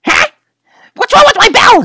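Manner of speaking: plain reading voice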